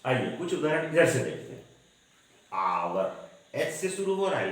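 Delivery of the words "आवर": "आवर" opening on a long aa, no h; "आवर" said slowly with the h dropped; silent h. Shown as a demonstration. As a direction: draw out "आवर" from the vowel, pitched low. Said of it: "उच्चारण एच का नहीं हो रहा है".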